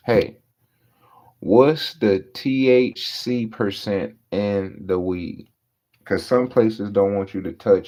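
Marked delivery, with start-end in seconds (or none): 0.1-5.5 slow, spelled-out question to a clerk; drawn-out question. Retold or imitated. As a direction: ask it slowly, like the tenth time. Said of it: "you asking this dumbass motherfucker over and over again".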